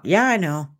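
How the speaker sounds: offhand, bored "Yeah, I know" with a falling end; dismissive shrug. Retold or imitated. Shrugging off a familiar statement, dismissive and bored. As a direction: toss it off like old news. Fox News is gonna be on at five o'clock.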